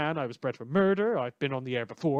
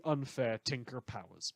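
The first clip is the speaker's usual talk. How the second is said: sarcastic whisper; 2 semitones lower, 6 dB quieter than usual. Acted. Snide aside about rival heroes.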